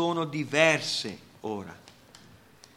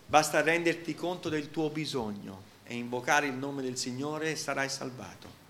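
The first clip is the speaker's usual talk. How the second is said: usual speaking voice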